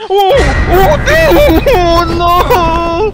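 alarido agudo y muy fuerte, alargado; grito de susto. Real, not acted. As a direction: grita de puro susto, con la voz muy arriba. Sobresalto en juego de terror. (grito de susto real, en español)

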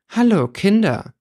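put-on high, slow, sugary greeting to the children; smug stepmother. A narrator's reading. Stepmother greets the children she despises, high and slow. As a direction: lord it over them with a drawn-out hello. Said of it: "sagte sie, die Frau".

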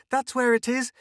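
high, loud desperate scream. A narrator's reading. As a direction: scream to drag him upstairs. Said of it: "she screamed".

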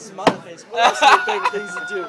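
high and loud through laughter; laughing. Real, not acted. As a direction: squeak the question out laughing. Laughing while reading text.

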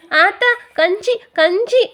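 put-on high child's call, rising; a hungry boy calling for gruel; eager little boy calling his mother. A narrator's reading.

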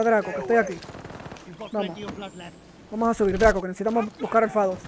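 ordinary delivery

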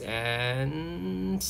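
long, soft held build-up; suspenseful drawn-out word. Real, not acted. Narrator awaiting the catch, drawn-out suspense.

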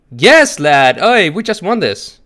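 high, loud triumphant shout; excited cheering. Real, not acted. Player to teammate as victory nears, excited cheering. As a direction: cheer your teammate on at full voice.

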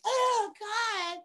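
very high, loud mock squeal; imitating excited girls. Imitating girls hit by water at a show, high mock squeal. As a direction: squeal it like girls in the front row. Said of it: "these girls were like".

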